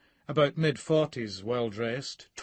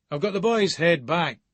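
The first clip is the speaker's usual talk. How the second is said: plain reading voice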